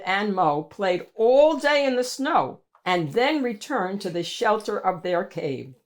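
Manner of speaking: plain reading voice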